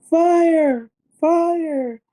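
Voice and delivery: very high, drawn-out cry of alarm; imitated child's scream. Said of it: "is screaming".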